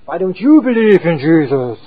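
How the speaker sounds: mock challenging. Pushy street passerby challenging him.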